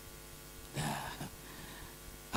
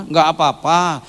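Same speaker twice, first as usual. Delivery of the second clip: louder, drawn-out dismissive retort; quarrelling wife; 6 semitones higher, 10 dB louder, slower than usual. Retold or imitated. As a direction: imitate a wife brushing off a warning. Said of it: "akhirnya ya ribut".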